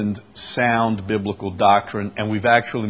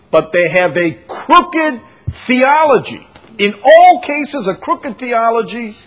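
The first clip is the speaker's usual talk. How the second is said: emphatic warning; 10 semitones higher, 7 dB louder than usual. Real, not acted. Preacher warning the class about false prophets' theology, forceful. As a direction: raise the voice and stretch each key word.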